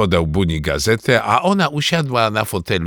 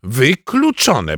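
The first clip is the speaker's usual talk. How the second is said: slow, emphatic mother; drawn-out, decisive veto; firm refusal; 3 semitones higher, much slower than usual. Acted. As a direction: rule it out, stretching the word. Mother firmly refuses the sofa plan.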